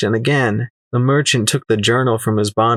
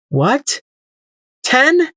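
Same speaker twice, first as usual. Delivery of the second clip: near-scream of disbelief. Acted. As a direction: pitch leaping sharply up, incredulous. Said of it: "I almost screamed it".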